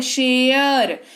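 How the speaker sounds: शेयर drawn out very slowly; slow pronunciation demo. Shown as a demonstration. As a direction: stretch शेयर out for the class. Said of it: "एयर सुनाई दे रहा है". (शेयर को बहुत धीरे खींचकर)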